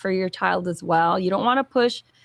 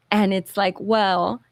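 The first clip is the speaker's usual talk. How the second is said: ordinary delivery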